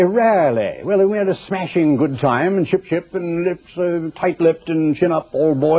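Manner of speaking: exaggerated British accent. Preacher imitating Britons thought to sound effeminate, exaggerated British accent. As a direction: put on a jolly-good British accent. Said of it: "they do talk just a little bit differently".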